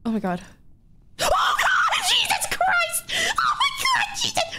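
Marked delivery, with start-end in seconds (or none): 1.2-4.5 very high shrieks, half laughing; terrified scream. Real, not acted. Player startled by a kill, screaming. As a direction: scream in sudden fright. Sudden scare in game.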